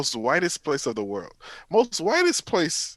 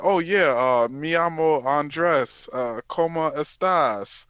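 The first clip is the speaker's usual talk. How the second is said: slow, higher put-on Spanish accent; mock Spanish accent; 3 semitones higher, slower than usual. Joking he'd fake being Mexican, slow mock Spanish accent. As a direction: ham up Duolingo Spanish, dragging each word. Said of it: "me pretending I'm Mexican".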